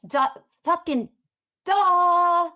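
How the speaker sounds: loud, high, drawn-out duh; sarcastic duh. Real, not acted. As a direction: make it blatantly obvious, dragging out the last duh. Mocking an obvious point.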